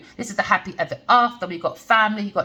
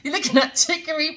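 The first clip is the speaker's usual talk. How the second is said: laughing; 8 semitones higher than usual. Real, not acted. Laughs at own accent.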